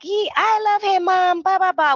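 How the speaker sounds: high, put-on daughter's protest; hammed-up whiny daughter; mock whining. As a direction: imitate a lovestruck girl protesting up high. Retold daughter protesting to mother.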